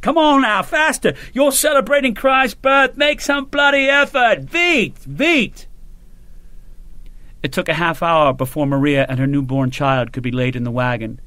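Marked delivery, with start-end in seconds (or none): 0.0-5.7 shouted. A narrator's reading. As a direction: roar them on, half joking, half exasperated. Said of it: "Sharp shouted".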